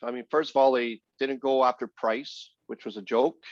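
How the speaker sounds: usual speaking voice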